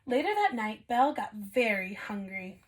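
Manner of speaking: ordinary delivery, nothing marked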